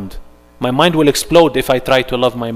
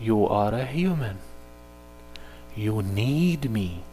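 softer and very slow, tender; gentle divine voice; 4 semitones lower, 12 dB quieter, slower than usual. Acted. Allah reminding the human, soft and slow. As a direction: speak it softly and slowly, like a gentle reminder. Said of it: "Allah keeps on telling you".

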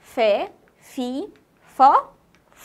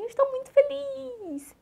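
high, stretched-out happy reply; acted happiness; 10 semitones higher than usual. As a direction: play up being very happy, pitched way up. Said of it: "E você fala assim".

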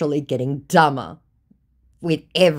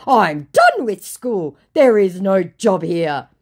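loud, slow, higher-pitched protest; mock indignant scammer; 5 semitones higher, 8 dB louder, slower than usual. Scammer protesting he is done with school, mock indignant. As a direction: declare it in hammed-up grievance.

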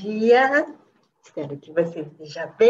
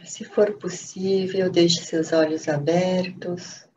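calm, unhurried meditation voice with long pauses; guided meditation; 3 semitones lower than usual. Acted. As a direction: guide them gently into stillness, phrase by phrase.

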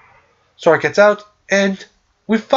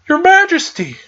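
excited greeting; 11 semitones higher than usual. Retold or imitated. The gang greets King Mickey, excited high cry. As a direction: call out thrilled to see the king.

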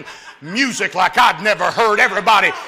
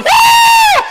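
very high, loud church whoop; imitating the men's whoop; 11 dB louder than usual. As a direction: whoop like the excited men. Men shouting in church.

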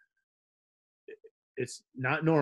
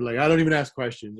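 ordinary talk, nothing marked

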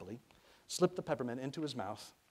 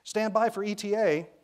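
high, loud radio shout; young woman yelling into a radio; shouting in windstorm; 11 semitones higher, 11 dB louder than usual. A narrator's reading. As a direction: shout it over roaring wind. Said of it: "as if she was shouting in a windstorm".

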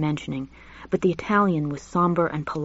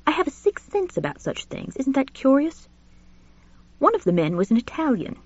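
put-on lightly raised, fussy elderly gentleman; a genteel old professor gossiping, pitched up; Julian's voice; 7 semitones higher than usual. Narrator voices Julian confiding to Richard, genteel and high.

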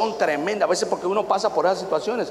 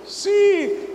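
very high little granny squeak; old lady voice. Retold or imitated. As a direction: answer like a tiny old lady. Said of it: "old lady answers".

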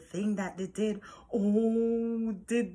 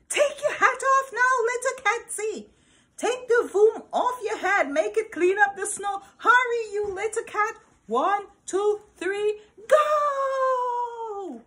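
much higher, louder, laughing command; yelled; 13 semitones higher, 5 dB louder than usual. Acted. Cat gleefully yelling at little cat to release the Voom. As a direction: holler it gleefully, counting down to go. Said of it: "Then he yelled".

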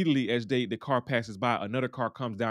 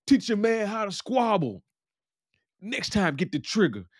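mock taunting shout; 10 semitones higher, 4 dB louder than usual. Jerome calls out after beating the guy, taunting shout. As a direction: imitate a fighter calling out a parting jeer.

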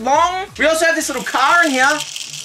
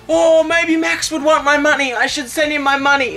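mock child voice; 4 semitones higher than usual. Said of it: "let's say you're a child".

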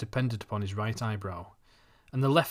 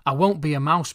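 firm, louder and higher on won't; determined; 4 semitones higher, 7 dB louder than usual. A narrator's reading. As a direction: set your jaw and insist. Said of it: "with a certain amount of determination".

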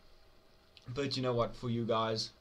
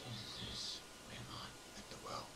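hushed unvoiced whisper; 11 dB quieter than usual. Real, not acted. Scared player whispering to himself, hushed. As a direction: whisper as if hiding from something. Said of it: "I don't know how I'm talking like this".

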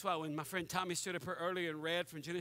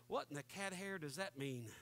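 puzzled under breath; 4 semitones lower, 9 dB quieter than usual. Retold or imitated. Listener puzzled by verse.

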